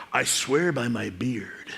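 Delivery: slow, low, mock-grave vow; hammed-up gravity, drawn out; solemn oath. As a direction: swear it softly and weightily. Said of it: "they would swear by their beard".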